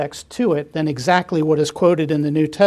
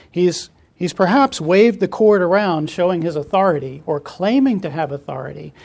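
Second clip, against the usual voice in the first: ordinary talk, nothing marked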